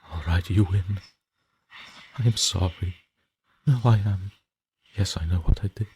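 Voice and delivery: loud, frantic begging that builds to a cry; desperate pleading. Acted. Haunted man begging the screaming girl to stop, frantic loud pleading. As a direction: plead with her for your life, voice cracking.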